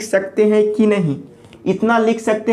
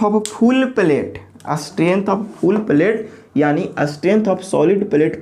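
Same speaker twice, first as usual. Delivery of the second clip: very slow, word by word; slow dictation; much slower than usual. Shown as a demonstration. Teacher dictating a definition to the class, very slow. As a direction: spell it out slowly for students to write down.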